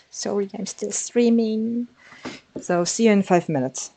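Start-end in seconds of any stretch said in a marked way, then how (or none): 0.1-1.9 polite apology. Retold or imitated. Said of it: "I'll be able to tell her".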